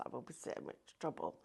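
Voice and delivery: a secret that must not get out, in a whisper; real whisper. Real, not acted. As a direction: whisper it so he won't hear. Confiding a secret about husband.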